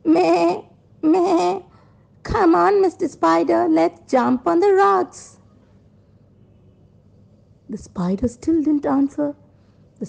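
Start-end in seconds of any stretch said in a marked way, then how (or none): 0.0-5.4 high bleating goat voice; high storybook goat for children. Acted. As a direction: bleat twice, then urge the spider on in a high voice. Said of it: "The goat said".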